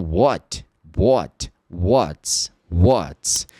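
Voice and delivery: What and What's with an o-like vowel; wot, wots. Shown as a demonstration. Teacher drilling What with o vowel. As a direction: round the a toward o. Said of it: "ele acaba sendo mais pra O".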